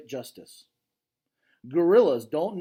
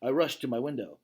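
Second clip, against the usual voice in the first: ordinary delivery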